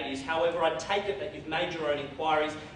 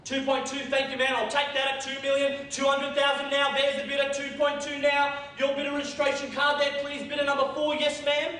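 auctioneer calling; 10 semitones higher, 3 dB louder than usual. Acted.

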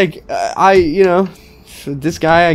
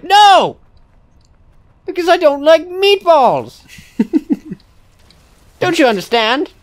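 mock indignation; 9 semitones higher than usual. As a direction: cut in with a high, hammed-up protest. Acted quarrel over meatballs.

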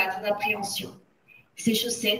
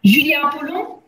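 loud, raised sudden bark; aboiement brusque; 4 semitones higher, 7 dB louder than usual. A narrator's reading. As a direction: bark the name like a harsh headmistress. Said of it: "aboya brusquement Le Gourdin". (aboyer brusquement)

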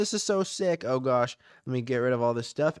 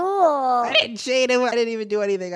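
loud denial; 9 semitones higher, 6 dB louder than usual. Acted. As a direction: drag out the protest of innocence. Denying world-edit cheating.

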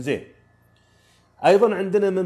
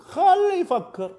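high, slow, scoffing خلي يفكر; defiant let him; 5 dB louder, much slower than usual. Real, not acted. Coach telling learners to ignore mockers, scoffing. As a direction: toss it off, let him think.

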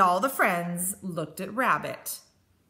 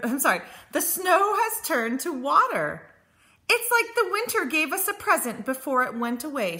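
lightly voiced happy little creature, pitched up; a hint of an excited animal character; delighted storybook animals; 7 semitones higher, 4 dB louder than usual. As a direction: read with high, gentle wonder.

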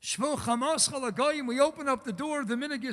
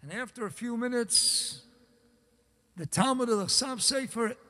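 ordinary delivery

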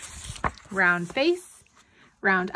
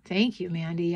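ordinary delivery, nothing marked

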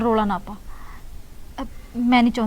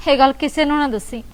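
usual speaking voice

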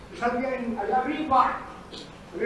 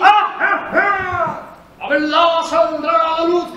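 high loud gotcha cries; triumphant shout; 5 semitones higher, 10 dB louder, slower than usual. Acted. Triumphant outburst catching him.

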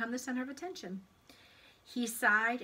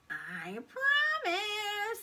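very slow, high, stretched promise; singsong little creature's oath; tempting pigtail; 13 semitones higher, 4 dB louder, much slower than usual. A narrator's reading. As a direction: draw the promise out sweetly. Pigtail swears to Paul.

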